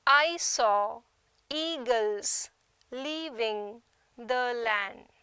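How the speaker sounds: "I saw / eagles / leaving / the land" slowly, foot by foot with pauses; measured two-syllable chunks in "I saw eagles leaving the land", each drawn out; iambic scansion. Shown as a demonstration. Teacher illustrating iambic meter to students, slow foot-by-foot scansion. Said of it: "the first syllable is unstressed and the second syllable is stressed".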